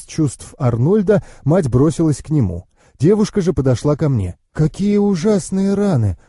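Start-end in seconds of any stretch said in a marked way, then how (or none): 4.5-6.2 slow, higher-pitched dismay; mildly appalled murmur. A narrator's reading. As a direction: draw out the dismay at the wounds. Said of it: "пробормотала она".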